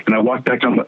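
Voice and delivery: ordinary talk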